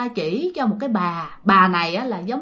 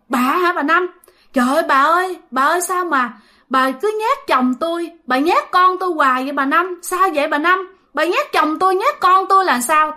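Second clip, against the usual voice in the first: high, aggrieved questioning; acted grandmother's upset plea; distressed confrontation; 6 semitones higher than usual. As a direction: confront the ghost in a raised pitch.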